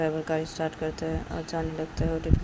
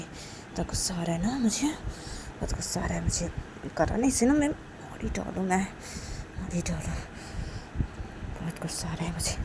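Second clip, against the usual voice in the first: seething whisper; 6 dB quieter than usual. Acted. Seething alone.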